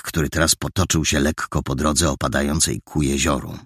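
ordinary delivery, nothing marked

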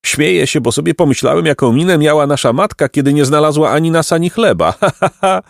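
put-on high, amused boy laughing as he talks; giggly high-pitched glee, ending in ha-ha. A narrator's reading. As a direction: tell it through mocking laughter. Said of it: "Placek nagle roześmiał się głośno".

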